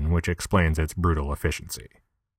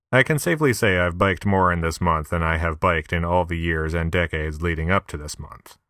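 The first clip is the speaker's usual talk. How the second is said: usual speaking voice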